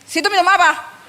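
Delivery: high, brusque question; mock-surly hostess voice; imitated rude offer. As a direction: ask it curtly, as if resentful. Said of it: "Kalau dia tanya gini".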